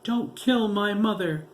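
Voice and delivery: high, loud sobbing cry; sobbing plea. A narrator's reading. Girl begging the captor, sobbing. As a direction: beg through sobs for her mother. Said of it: "the girl sobbed".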